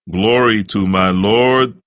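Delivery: ordinary talk, nothing marked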